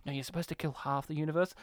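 hushed, quick aside, imitated; whispered correction. Someone correcting the villain on the plan, hushed aside. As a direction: drop it low and soft, hurried. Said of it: "someone whispers over".

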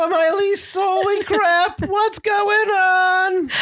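high, slow, bewildered squeak; Morty voice. Real, not acted. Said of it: "You sounded like Morty for a second".

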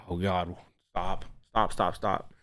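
low, soft, urgent hushing; imitating an embarrassed parent under their breath; mock hushed pleading. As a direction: quietly beg the kid to stop. Parent hushing embarrassing child in public.

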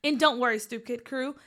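ordinary delivery

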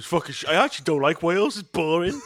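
loud, high mock complaint; mock rant. Mock rant as sober self.

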